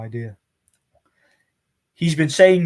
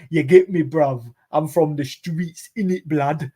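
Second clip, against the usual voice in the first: imitating a fake London street accent, louder at the start; mock London gangster accent; 2 semitones higher, 3 dB louder than usual. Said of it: "his old fake gangster accent".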